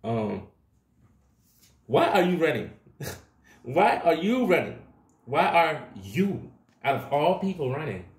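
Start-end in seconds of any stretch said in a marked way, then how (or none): 1.8-8.1 raised, louder pitch, half laughing in disbelief; exasperated calling out. Acted. Tarot reader loudly scolding her viewers for running from it.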